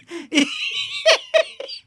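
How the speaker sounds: sudden shrill laugh; giggling burst; high burst of laughter. Real, not acted. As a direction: crack up at the pun. Host laughs at Suji joke.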